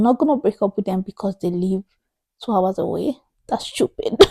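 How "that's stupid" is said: squeaky exasperated that's stupid. Real, not acted. Exasperated outburst.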